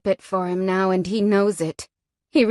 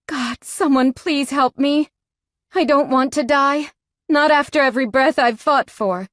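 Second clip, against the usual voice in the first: higher, desperate begging through pain; pleading in pain; 5 semitones higher, 3 dB louder than usual. A narrator's reading. Victim begging to be spared, desperate plea. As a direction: plead for your life, voice lifted high.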